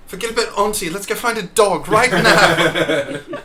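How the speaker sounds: excited. Real, not acted. Excited about petting dogs.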